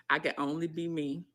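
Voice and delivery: usual speaking voice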